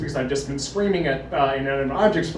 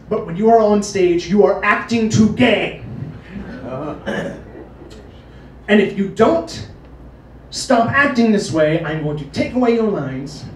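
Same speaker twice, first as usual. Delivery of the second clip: stern warning; 4 semitones higher, 6 dB louder than usual. Retold or imitated. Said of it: "he pulls me aside and he says".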